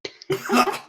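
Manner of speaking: high, loud laugh after swallowing water; spluttering laugh. Acted. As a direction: laugh it off, coughing up sea. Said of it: "Harry gets mouthful".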